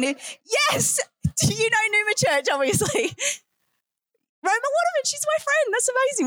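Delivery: high, bright burst of excitement; real delight at a shared friend; excited. Real, not acted. Preacher chatting with Jesse on the front row, excited.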